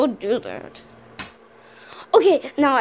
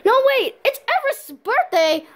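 higher, gasping alarm; panicked realization; 9 semitones higher than usual. Acted. Remembering Everest's birthday, alarmed and high. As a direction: blurt the discovery in a panic.

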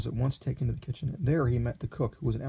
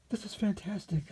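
high, slow, hushed wonder; awed cry; 10 semitones higher, 4 dB quieter than usual. A narrator's reading. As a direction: breathe it out in amazement.

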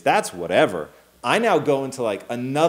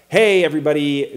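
loud, raised host's call; imitated stage announcer, pitched up; stand-up emcee; 4 semitones higher, 8 dB louder than usual. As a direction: greet the room like a comedy emcee. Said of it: "this guy comes on and he's like".

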